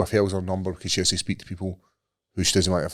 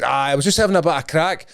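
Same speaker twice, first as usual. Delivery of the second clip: higher mock version of the caller's own Scottish accent; higher-pitched retold excuse in a Scottish accent; caller's real accent; 6 semitones higher than usual. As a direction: imitate the caller dropping into his native Scottish. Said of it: "put on his real accent".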